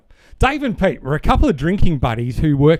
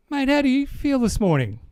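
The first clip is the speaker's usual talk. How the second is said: a higher, lightly voiced Aussie bloke; phone call to his drinking mate, lightly voiced and higher; 9 semitones higher than usual. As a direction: play Pete a bit higher. Pete voiced in the dad joke.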